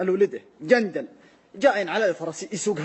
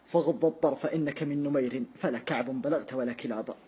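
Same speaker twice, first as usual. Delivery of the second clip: poem recitation; 4 semitones lower than usual. Acted. Recites Jarir's famous verse.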